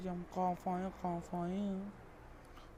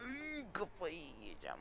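slow, soft, strained groan; stifled frustration; 9 dB quieter than usual. Real, not acted. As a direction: squeeze the words out quietly in exasperation. Suppressed frustration at game.

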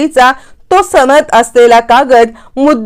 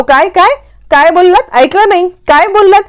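higher, sneering "what did you say?"; a rival lawyer pretending not to hear; mocking interruption; 6 semitones higher than usual. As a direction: cut her off again and again, smugly. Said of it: "तीन चार वेळा अबोलीला interrupt करतो".

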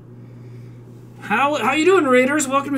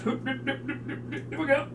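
usual speaking voice

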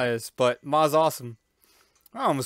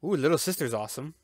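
ordinary talk

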